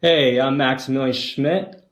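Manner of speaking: ordinary talk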